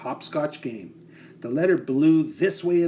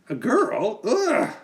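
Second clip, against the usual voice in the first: put-on high, scornful "ugh" of a boy; disgusted boy; 9 semitones higher, 4 dB louder, slower than usual. Eddie sneers as Amy walks in, high disgusted yelp. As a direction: sneer at the girl's arrival. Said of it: "said Eddie".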